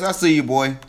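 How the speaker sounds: ordinary talk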